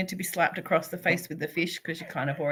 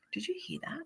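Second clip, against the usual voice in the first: put-on high, soft girlfriend voice; softly asking, lifted up high; girlfriend's hushed question; 10 semitones higher, 12 dB quieter, faster than usual. Girlfriend after yoga, asking quietly in a high voice. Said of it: "she came out and she goes".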